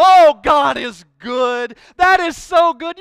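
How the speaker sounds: shouted praise, drawn out slowly; joyful shout. Retold or imitated. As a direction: cry it out in delighted praise. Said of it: "and you go".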